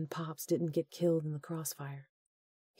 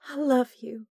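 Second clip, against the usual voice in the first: tender; 9 semitones higher, 3 dB louder than usual. Acted. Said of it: "she uttered".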